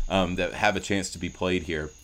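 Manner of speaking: normal talking voice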